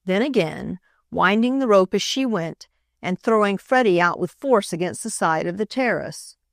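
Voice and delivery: ordinary delivery, nothing marked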